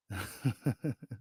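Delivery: low, soft rhythmic chuckle. Real, not acted. Host amused after his own impression, soft chuckle. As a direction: chuckle quietly to yourself.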